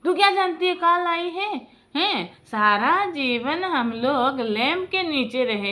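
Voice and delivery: high, indignant acted-out rebuke; imitated father-in-law's furious scolding; angry old man. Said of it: "बूढ़ा को इतना गुस्सा आया".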